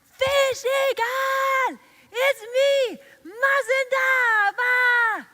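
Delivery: high, loud, slow call across the sky; shouting. Acted. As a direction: yell up to the eagle far above. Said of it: "Mazindaba shouted".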